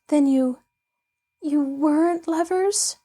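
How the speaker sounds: mild dismay, voice lifting in disbelief; a touch of a girl's hopes falling; tragic dismay. Acted. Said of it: "voice was tragic with dismay".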